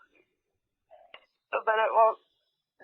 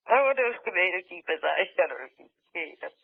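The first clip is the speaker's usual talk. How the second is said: ordinary talk, nothing marked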